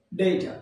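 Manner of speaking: "ডেটা" said as "DAY-tha", aspirated t; correct pronunciation. Shown as a demonstration. Teacher giving learners the real form, "ডেটা" as DAY-tha. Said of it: "আসলে এর উচ্চারণ হচ্ছে".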